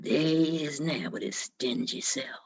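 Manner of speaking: low, slow, sneering barfly voice; sneering townsman. Acted. One man in the pub sneers as Jack walks in, low and slow. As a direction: drawl it low like a scornful drinker at the bar.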